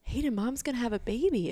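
bubbling with news; telling her little girl the big news, excited. Retold or imitated. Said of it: "in shock and excited".

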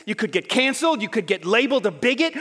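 normal talking voice

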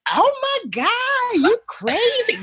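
high, slow, stretched squeal of shock; excited scream. Retold or imitated. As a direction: squeal it out, drawn long and high. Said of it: "I start screaming".

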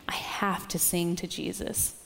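soft, voiceless whisper. Retold or imitated. Retelling the pest control kid's devotion, whispered. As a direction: breathe it like a confession. Quoting kid's heartfelt need to sing.

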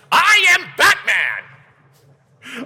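the comedian's squawking impression of a superhero; Gilbert Gottfried. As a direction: yell it in a grating comic screech. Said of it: "Gilbert Godfrey".

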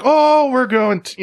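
high, loud, slow leprechaun-style Irish; hammed-up Irish accent. Host failing at an Irish accent, high and hammed-up. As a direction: ham up an Irish leprechaun, drawn out. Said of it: "Yeah, I'm like".

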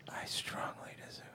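mocking whisper echo; giggly prank whisper. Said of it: "The whispering into the mic".

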